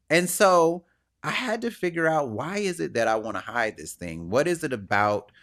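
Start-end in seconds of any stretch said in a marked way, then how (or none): none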